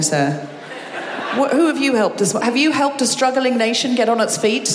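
usual speaking voice

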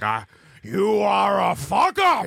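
put-on slow, shouted Italian accent; hammed-up Italian father yelling; mock Pacino Italian. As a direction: bellow it like hammy Al Pacino. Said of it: "He's like".

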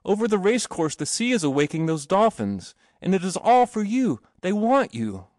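high, eager proclamation; excited calling. A narrator's reading. Boy announcing the procession to his companion, excited calling. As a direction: call out the marvels, pitch lifted.

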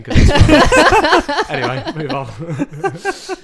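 high, loud, laughing through it; giggling while talking. Real, not acted. Laughing after cough.

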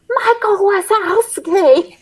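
high, slow drawn-out yell of his name; hammed-up high call; mock Celia yell. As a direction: shriek the name like an angry girlfriend. Celia yelling in movie retelling.

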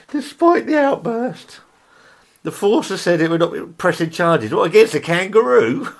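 high-pitched reading through laughter; cracking up. Real, not acted. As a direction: fight the giggles to get the news out. Cracking up while reading the news.

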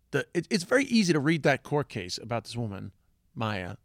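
ordinary talk, nothing marked

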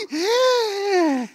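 long, high squeaked gasp; throat-drying gasp. Retold or imitated. Preacher showing how bad news dries the throat, high gasp. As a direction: suck in air in mock shock. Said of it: "se le seca a uno la garganta".

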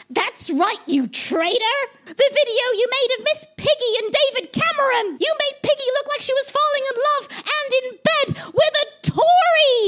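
very high, outraged little frog voice; tiny nephew frog calling her a traitor; accusing Robin. Acted.

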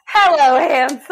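high, loud excited echo of the greeting; loud Wonka echo. Retold or imitated. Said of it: "He's like".

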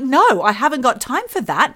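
loud, high acted exasperation; impatient refusal. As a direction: brush off the offer, mock indignant. Acting out impatient refusal of tea.

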